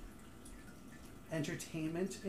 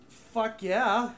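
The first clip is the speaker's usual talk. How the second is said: excited; 4 semitones higher, 7 dB louder than usual. Retold or imitated. Imagined reaction to job offer.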